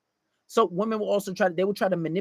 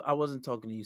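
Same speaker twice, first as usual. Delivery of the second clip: ordinary talk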